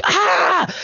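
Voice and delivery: high-pitched mock scream; woman's startled scream, acted. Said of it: "She screams".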